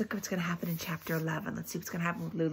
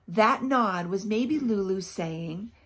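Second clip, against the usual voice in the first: usual speaking voice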